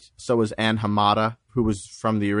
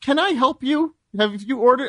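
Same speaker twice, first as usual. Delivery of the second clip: mock barista politeness; 12 semitones higher than usual. Barista voiced in story.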